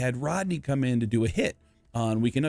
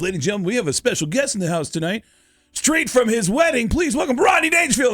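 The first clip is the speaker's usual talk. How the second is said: hyped-up stage announcer, building higher and louder; club emcee; 10 semitones higher, 3 dB louder than usual. Retold or imitated. Emcee welcoming a special guest to the stage, hyped announcer. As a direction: build to a big, high-pitched introduction. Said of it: "the M C goes".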